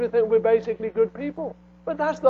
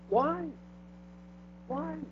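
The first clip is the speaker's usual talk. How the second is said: ordinary talk, nothing marked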